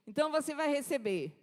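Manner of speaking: ordinary talk, nothing marked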